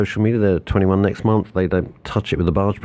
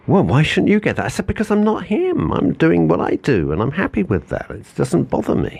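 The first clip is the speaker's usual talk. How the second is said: heated retelling; 9 semitones higher than usual. Retold or imitated. Retold argument, voice raised.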